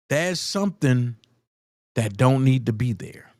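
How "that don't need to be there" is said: ordinary talk, nothing marked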